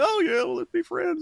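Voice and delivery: very high, gullible sing-song; mock naive Carter. Mocking Carter as a gullible pushover, very high. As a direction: sound sweetly clueless and eager. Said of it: "so if you come up to him".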